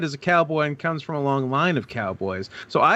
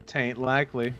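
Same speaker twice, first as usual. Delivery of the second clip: cowboy drawl; 4 semitones lower, 6 dB quieter than usual. Retold or imitated. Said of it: "Egghead cutting a good promo?".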